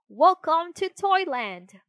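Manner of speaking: high, loud, slow welcome call. Acted. Tin soldier greeting the washed-up puppet, loud call. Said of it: "called a tin soldier".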